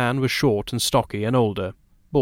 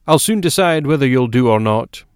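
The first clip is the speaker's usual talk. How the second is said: louder, brisk interruption; McGovern cutting in; 3 semitones higher, 6 dB louder than usual. Acted. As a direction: cut in firmly with raised volume. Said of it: "McGovern cut in".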